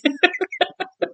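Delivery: a short, high burst of laughter; soft giggle at the joke; light laugh. Real, not acted. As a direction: laugh it off, amused. Laughing at Frank's excuse.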